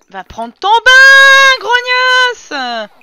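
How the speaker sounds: loud, high, drawn-out mock yell; yelled order. Streamer sends game child to the bath, yelled order. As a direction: bellow it slowly like a fed-up parent.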